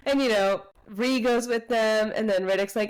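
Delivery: usual speaking voice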